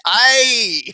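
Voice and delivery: a quick imitated ay of pain; Spanish-speaking ay, imitated; Spanish pain cry. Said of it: "They say".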